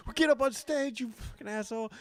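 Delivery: high-pitched mock outburst at a heckler; losing his mind. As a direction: imitate a comic snapping at a heckler. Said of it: "loses his mind on him".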